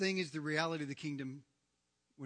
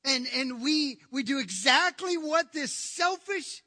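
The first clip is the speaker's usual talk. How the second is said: raised, emphatic sermon voice; forceful preaching; 10 semitones higher, 12 dB louder, slower than usual. Acted. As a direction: preach it loud, leaning on the key words.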